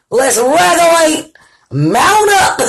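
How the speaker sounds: high, loud playful rally cry; rally the crew with a laugh; hype call. Real, not acted. Host rallying the queens who just arrived, playful and hyped.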